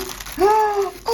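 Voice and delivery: real, high gasp of surprise; surprised gasp. Real, not acted. Vlogger opens her forgotten present, surprised gasp. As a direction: gasp as the memory hits.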